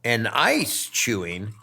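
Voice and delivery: slow, low and solemn; slow and grave. Pretend. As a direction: spell it out gravely.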